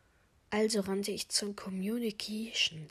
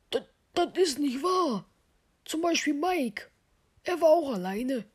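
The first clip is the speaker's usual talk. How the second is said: high-pitched, stammered start of a protest; protesting accused character; 6 semitones higher, 4 dB louder than usual. Acted. Accused crewmate denies it and points at Mike, high protesting voice. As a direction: object in an acted, higher voice. Figur widerspricht der Beschuldigung.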